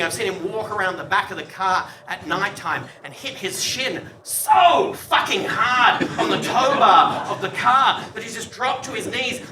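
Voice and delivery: ordinary delivery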